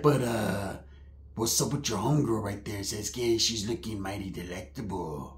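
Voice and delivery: low, soft, sly cousin voice; hushed mock come-on; flirting cousin. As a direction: ogle quietly in the cousin's put-on voice. Plays cousin eyeing the homegirl.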